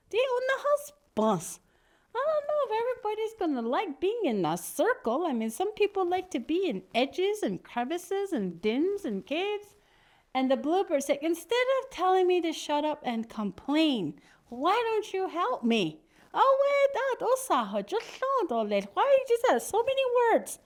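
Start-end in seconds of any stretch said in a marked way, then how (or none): 0.1-9.7 very high-pitched bird voice; fussy objecting bird. Acted. Brown bird objects to round house. 11.2-16.0 high-pitched indignant retort; complaining bluebird. Acted. 16.4-20.6 high-pitched fussy protest; exasperated bird. Acted. Brown bird grumbles at the request.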